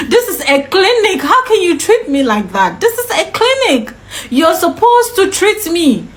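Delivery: high, exasperated outburst; heated re-enacted complaint, stressing each point; indignant protest. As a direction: scold them in disbelief, pitch jumping up. Acted protest at clinic dismissal.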